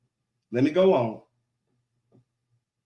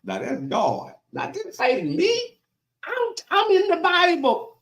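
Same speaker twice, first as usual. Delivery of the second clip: very high, squeaky disclaimer; comic high-pitched not-my-words protest; mock-defensive protest; 12 semitones higher, slower than usual. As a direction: plead innocence way up high. Preacher disclaims responsibility for the words.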